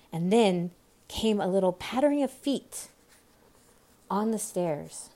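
plain reading voice

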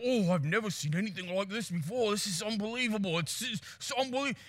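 put-on softly amazed South African accent; understated South African commentary; South African guide. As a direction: marvel quietly as a South African safari guide. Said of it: "And the guide's like".

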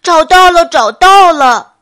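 very loud, high yell of discovery; excited shout. Acted. Bear shouts to his friends after the splash, elated. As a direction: holler it out, stretching the last one. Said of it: "大声喊了起来".

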